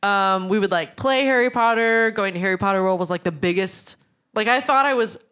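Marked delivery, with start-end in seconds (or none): none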